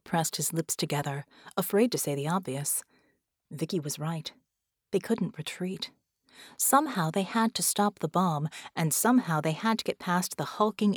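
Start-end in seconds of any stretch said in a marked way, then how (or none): none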